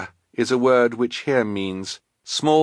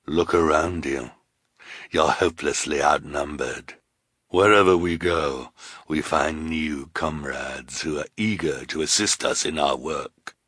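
low villain voice; calm menace, pitched low; low, understated threat from a villain; sinister woman; 4 semitones lower than usual. Acted.

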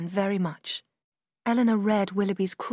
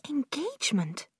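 startled sister; 6 semitones higher, 3 dB quieter than usual. A narrator's reading. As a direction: echo the word in disbelief.

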